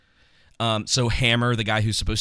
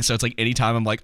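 ordinary delivery

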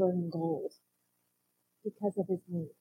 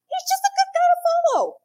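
mock casual; 7 dB louder than usual. Mocking disciples' casual view.

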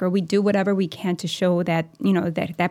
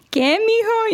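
mom's voice; 3 dB louder than usual. Retold or imitated. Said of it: "my mom's like".

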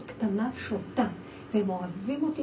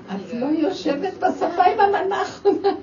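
laughing tease; 9 semitones higher, 6 dB louder than usual. Retold or imitated. Teasing the granddaughter, laughing.

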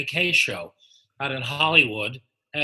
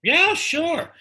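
eager; 12 semitones higher, 4 dB louder than usual. Retold or imitated. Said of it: "We said".

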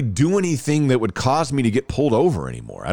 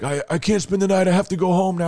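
higher-pitched, mildly panicked imitation; retold freak-out; 7 semitones higher, faster than usual. Ticketed friend telling his buddy he must go home, mildly panicked. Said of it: "we both were freaking out".

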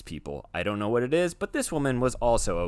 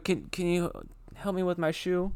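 higher, softer put-on voice asking for help; mimicking woman's plea; 6 semitones higher, 4 dB quieter than usual. Retelling the thrift store woman asking him for help, lightly imitated. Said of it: "she goes".

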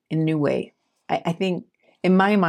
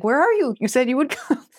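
higher-pitched, half-laughing mock plea; desperate plea; 7 semitones higher than usual. Wayward disciple begging God on their knees, mock desperate. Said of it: "I'll get desperate".

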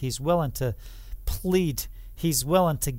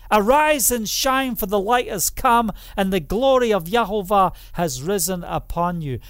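higher, louder exhortation; preaching proclamation; 3 semitones higher, 5 dB louder than usual. Real, not acted. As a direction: call them to rise up. Proclaiming scripture loudly.

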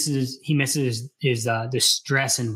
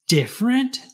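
bitter incredulous echo, much higher; 10 semitones higher, 3 dB louder than usual. A narrator's reading. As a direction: throw the word back with bitterness. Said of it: "She heard the bitterness in his tone".

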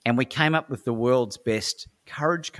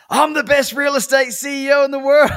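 loud, high yell with laughter; laughing yell; 9 dB louder than usual. Retold or imitated. CEO out of his seat yelling his claim, loud and laughing. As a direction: bellow it at the top of your lungs. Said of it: "yelling at the top of his lungs".

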